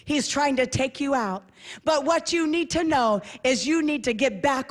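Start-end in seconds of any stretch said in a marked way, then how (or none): none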